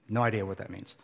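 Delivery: usual speaking voice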